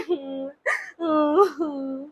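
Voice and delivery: soft drawn-out sob; sobbing. Acted. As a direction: let out a quiet weeping. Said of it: "तू रोनो बंद कर बेटा". (धीमी सिसकी)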